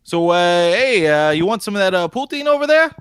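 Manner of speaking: hammed-up Canadian offering poutine; regular Canadian accent. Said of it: "your authentic Canadian accent".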